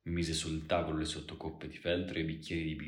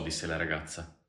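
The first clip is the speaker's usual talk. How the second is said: ordinary delivery, nothing marked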